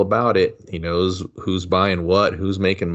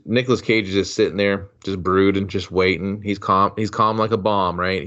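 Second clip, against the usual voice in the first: ordinary delivery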